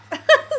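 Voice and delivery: short loud high laugh; embarrassed laugh. Real, not acted. After feeling like crap.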